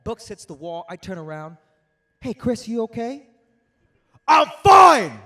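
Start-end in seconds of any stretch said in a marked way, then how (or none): 2.2-3.3 concerned roommate. Retold or imitated. Roommate checking on angry friend. 4.3-5.2 furious shouted denial, much higher and louder; angry yell. Retold or imitated. Angry friend snapping at his roommate, shouting.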